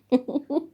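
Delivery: a short bright laugh that jumps up high; quick laugh. Real, not acted. Telling the movie story, she laughs at her own stumble.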